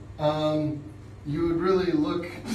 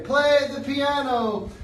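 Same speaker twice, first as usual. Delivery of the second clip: class chorus; 9 semitones higher, 5 dB louder, slower than usual. Retold or imitated. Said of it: "Everybody say".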